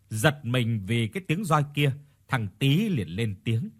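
usual speaking voice

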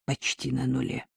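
low, soft, slightly hoarse report. A narrator's reading. Co-pilot reporting oil almost gone, quiet hoarse voice. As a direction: drop pitch and volume, a touch hoarse. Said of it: "хрипло сказал Пашка".

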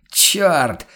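loud, sharp, high curse; barked curse. A narrator's reading. The hunter curses the stuck shutter, loud bark. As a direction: bark it out in anger. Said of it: "рявкнул Ван Ален".

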